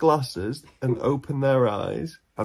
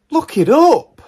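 exasperated shout; 12 semitones higher, 10 dB louder, slower than usual. Acted. Mocking husband's ambition.

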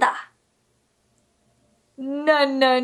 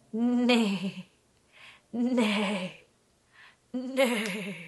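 lower, softer wavering neigh; horse neigh; 7 semitones lower, 8 dB quieter, much slower than usual. Shown as a demonstration. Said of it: "Can you neigh like a horse?".

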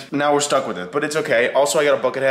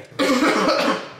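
coughing fit; 10 semitones higher than usual. Real, not acted. Coughing from gas.

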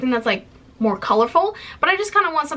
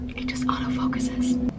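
soft whisper, half to herself; whispered aside; 7 dB quieter than usual. Real, not acted. Aside about the camera.

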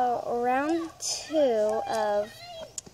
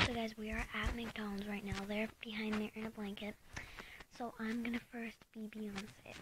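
whisper; 2 semitones lower, 12 dB quieter than usual. Real, not acted. Secret filming at drive-through.

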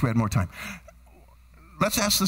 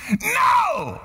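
emphatic refusal; 14 semitones higher than usual. Real, not acted. Preacher rejecting the congregation's view of prayer, emphatic refusal. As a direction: burst out in sharp protest. Rejects get-what-you-want idea.

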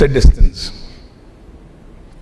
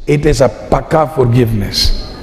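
ordinary talk

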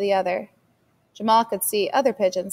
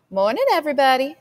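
lilting, higher sing-song hello with a rising end; sing-song greeting; 5 semitones higher, 4 dB louder than usual. A narrator's reading. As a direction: sing out the greeting to the whole house like a sleepy child. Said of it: "said in a sing-song voice".

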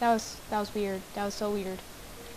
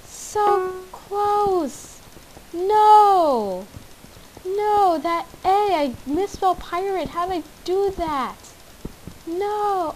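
high, drawn-out cries of dismay; high-pitched gamer meltdown, opening loud; real frustrated wail; 8 semitones higher, 5 dB louder, much slower than usual. Real, not acted. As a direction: wail in agony at a silly mistake. Misspelled pirate, raging at the miss.

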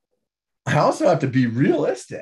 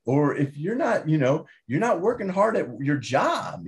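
ordinary delivery

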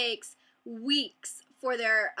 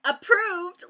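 high, loud, incredulous question; freaking out; 7 semitones higher, 8 dB louder than usual. Retold or imitated. Vlogger reliving reading her approval email, incredulous freak-out. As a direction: burst out in disbelief. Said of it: "I was, like, freaking out".

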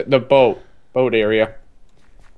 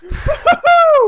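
high laughing cheer; laughing whoop. Real, not acted.

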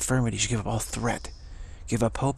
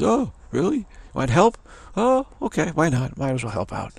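much higher, airy surprised dude voice; casual shrug, why not; laid-back kid; 6 semitones higher than usual. Retold or imitated. Street kid asked for help by the team, much higher airy dude voice. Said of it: "He goes".

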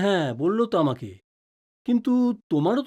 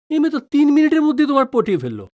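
teasing outburst; 7 semitones higher, 5 dB louder, faster than usual. Acted. Father teasing, exaggerated outburst.

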